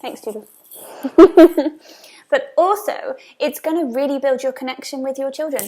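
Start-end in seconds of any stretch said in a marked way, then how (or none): 0.9-1.7 loud, high laugh; a sudden big laugh; burst of laughter. Real, not acted. Laughing at Judy aside.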